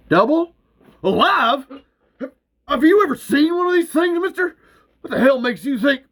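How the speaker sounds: high, loud incredulous shouting; outraged yelling. Acted. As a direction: blast the officer in disbelief. Earl outraged at the offer.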